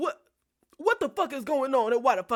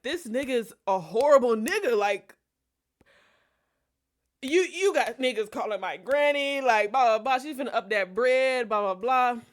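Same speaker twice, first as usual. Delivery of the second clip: louder, heated rant at mom; 5 dB louder than usual. Retold or imitated. Telling mom off about the man, louder. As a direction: vent it hard and fast.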